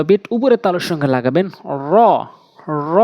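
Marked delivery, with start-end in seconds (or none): 1.9-3.0 "র" trilled with rounded lips; rounded heavy ra. Shown as a demonstration. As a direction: curl the tongue tip up, full-mouthed "র". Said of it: "এভাবে উচ্চারণ করবেন".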